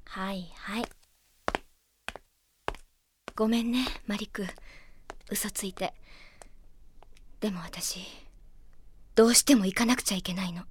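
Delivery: soft, low whispered apology; whispered regret after tricking Marik; guilty whisper. Acted. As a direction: murmur to yourself alone. Alone, confessing her lie quietly.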